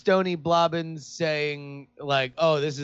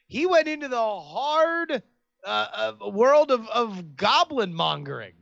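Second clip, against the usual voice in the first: dramatic; 6 semitones higher than usual. Acted. Dramatic announcement of new career.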